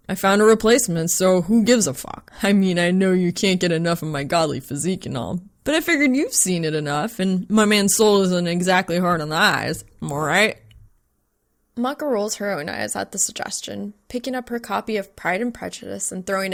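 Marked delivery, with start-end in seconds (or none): 0.1-10.6 loud, brash guy voice; brash male roommate bragging loudly; cocky roommate. Acted. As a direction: swagger it out loud.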